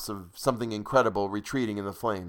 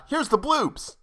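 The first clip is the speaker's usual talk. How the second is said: excited announcer; slower than usual. Pretend. Announcing the bloopers.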